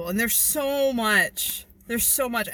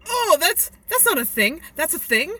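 higher, a bit louder surprise; surprised; 7 semitones higher, 5 dB louder than usual. Retold or imitated. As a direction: gasp it as a discovery. Retold surprised realization.

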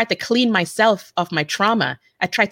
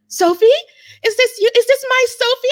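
high, frantic imitated mother; mom on the phone; 5 dB louder than usual. Sophie imitating her frantic mother on the phone. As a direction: squeal like a panicked mom hearing her daughter. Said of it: "she's like".

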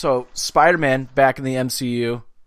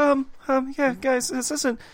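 squeaky Morty voice; 14 semitones higher, 4 dB quieter, slower than usual. Pretend. Mimicking the impression.

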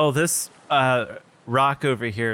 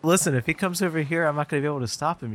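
hurried, raised-pitch plea; urgent warning; 5 semitones higher, faster than usual. Acted. Boy warns sea man the hunter may come, urgent. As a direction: press them urgently to listen.

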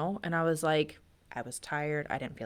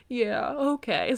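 slow, higher, lightly voiced little girl; daughter's small yes; 7 semitones higher, much slower than usual. Daughter agreeing to be held during dinner, slow and childlike.

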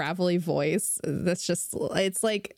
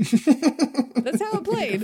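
laughing; 7 semitones higher, 5 dB louder, much slower than usual. Real, not acted. Laughs at own joke.

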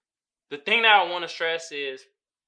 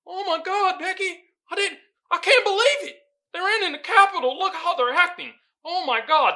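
much higher, gasping mock dismay; 9 semitones higher, 4 dB louder than usual. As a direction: clutch your pearls in a high voice.

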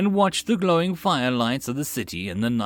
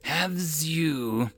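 hissing serpent; 5 semitones higher, 3 dB quieter than usual. Acted. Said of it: "The serpent rasped".

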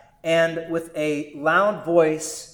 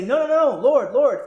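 pleading protest; 8 semitones higher, 3 dB louder than usual. Pretend. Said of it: "right for us to say".

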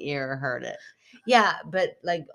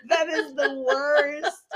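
high, slow laughing groan; 10 semitones higher, 5 dB louder, slower than usual. Real, not acted. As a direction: laugh till it cracks.

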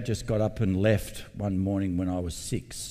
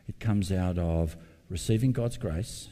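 normal talking voice

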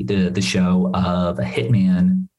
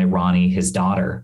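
normal talking voice

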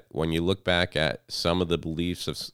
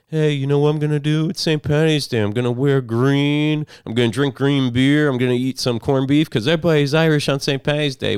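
high, loud mocking party voice; mocking friends; 8 semitones higher, 7 dB louder than usual. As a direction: ham up an eager friend claiming the holiday.

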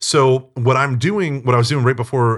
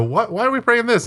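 much higher startled questions; retold alarm; 11 semitones higher than usual. New volunteer hearing about demon prayers: mock startled alarm. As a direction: imitate your younger self caught off guard.

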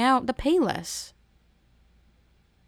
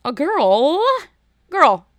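put-on loud, high, scandalized "girl"; appalled disbelief; 8 semitones higher, 9 dB louder, much slower than usual. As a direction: exclaim it in mock horror at her blunder. Appalled at the girl's mispronunciation.

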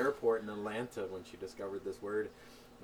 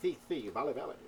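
"Si, si, vale" with S said as a lisped TH; Spanish lisp; 3 semitones higher than usual. Shown as a demonstration. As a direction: lisp the S like a Castilian speaker. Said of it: "they say their S's like T H's".

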